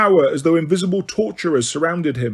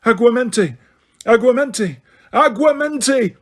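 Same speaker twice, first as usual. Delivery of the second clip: frantic spellcasting; 7 semitones higher than usual. A narrator's reading. Frantic repeated spell casting.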